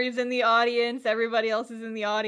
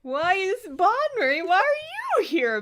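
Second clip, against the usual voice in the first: mock astonishment; 9 semitones higher than usual. Host questioning the manager's surprise entrance, mock astonishment. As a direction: wail the question, swooping way up.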